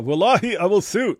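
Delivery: high, loud mocking voice; mock an outraged opponent.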